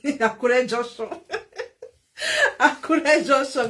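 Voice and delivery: laughing as the name is drawn out; slow, amused shout-out broken by laughter. Real, not acted. Streamer greets a viewer who sent a star, laughing.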